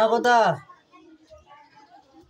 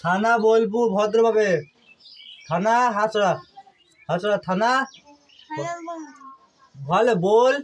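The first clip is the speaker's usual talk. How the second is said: normal talking voice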